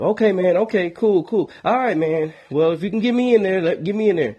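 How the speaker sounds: a chatty friend who wants to come over; eager friend calling. Retold or imitated. Caller voiced in phone story.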